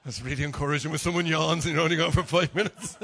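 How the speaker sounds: amused jab at a yawner; laughing. Real, not acted. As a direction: laugh it off good-naturedly. Someone yawns mid-sermon.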